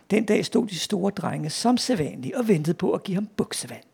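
put-on lively read-aloud for children; story narration. A narrator's reading. Author reads a schoolboy tall tale to an audience, animated storytelling. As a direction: tell a comic tale with animated character quotes.

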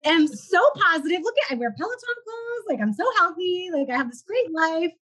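high, falsely bright self-satisfied voice; mock smug cheer. Guest mocks her past denial, high smug cheer. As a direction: sell the perfect healthy life, hammed up.

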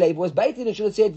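ordinary delivery

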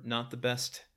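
normal talking voice

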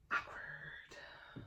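whispered aside. Real, not acted. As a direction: breathe the word out without voice. Awkward aside after joke.

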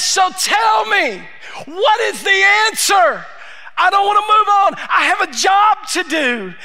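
a servant who won't be put off; urgent plea. Acted. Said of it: "There's an urgency here in the servant's voice".

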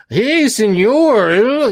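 mock Mexican accent. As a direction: caricature a Mexican accent, dragged out. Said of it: "incredibly racist Mexican accent".

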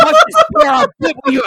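mock-screeching Anakin offering Padme the pear; hammed-up shriek. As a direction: screech it like a crazed lover. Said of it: "he's like".

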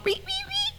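high, soft whistling wee wee wee; soft high-pitched whistled wee calls; mouse whistle. Retold or imitated. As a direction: imitate a tiny mouse whistle. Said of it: "with his little mouse whistle".

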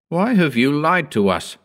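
understated indignant shout; angry shout. A narrator's reading. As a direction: demand it with restrained anger. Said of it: "he shouted".

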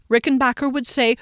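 usual speaking voice

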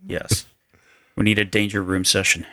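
usual speaking voice

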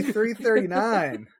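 slow, deliberate announcement; slow drawn-out time call. Real, not acted. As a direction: stretch each word out.

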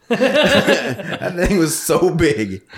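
high, slow laughing words. Real, not acted. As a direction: talk through the giggles. Laughing while describing.

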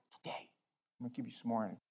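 soft reassuring voice of God; gentle reassurance. Retold or imitated. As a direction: soothe him quietly. God comforting softly.

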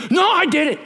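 put-on a proud Alabama caller in a big Southern accent. Caller on the radio insisting, in a Southern accent. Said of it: "Big Southern accent".

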